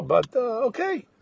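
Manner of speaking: high-pitched, hesitant okay; reluctant acceptance. Retold or imitated.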